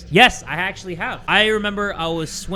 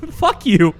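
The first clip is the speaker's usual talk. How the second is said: laughing retort; 3 semitones higher, 6 dB louder than usual. Real, not acted. Laughing at the game.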